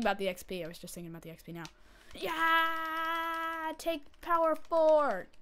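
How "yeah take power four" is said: triumphant cheer. Acted. Cheering enchant choice.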